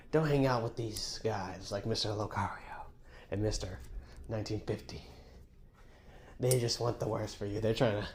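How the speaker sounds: soft, secretive murmur; hushed warning. Pretend. Host whispering a creepy warning to Steve. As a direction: lean in and warn him quietly.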